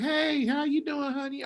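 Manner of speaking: mock-polite hello from the boyfriend's mom; fake sweet mom greeting. Mom greeting her son's side chick with mock politeness. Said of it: "She's just gonna be like".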